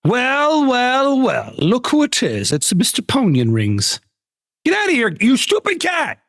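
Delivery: high, sing-song villain taunt, drawn out; hammed-up gloating over a rival, then a high squeal; mock taunting voice. Streamer voicing a gloating rival, high mock taunt.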